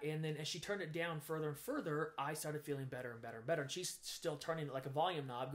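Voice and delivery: ordinary talk, nothing marked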